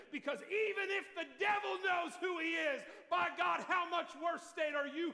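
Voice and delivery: loud shouted preaching; preaching at full force. Acted. Preacher rebuking the congregation, shouting. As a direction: thunder it at them.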